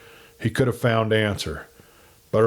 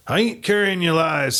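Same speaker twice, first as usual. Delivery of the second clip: raised, heated pushback; hot denial; 7 semitones higher than usual. A narrator's reading. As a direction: snap back, insulted. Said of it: "said Jordan hotly".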